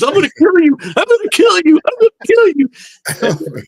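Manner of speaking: high-pitched imitation of enraged yelling; mock furious threat. Pranked big guy chasing his buddy round the boat, mock fury. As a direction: holler it way up high like the furious friend.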